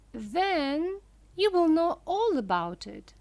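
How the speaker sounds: a hint of a sly host; Mister Wonka. A narrator's reading. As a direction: needle her with mock politeness. Wonka teases Missus Salt.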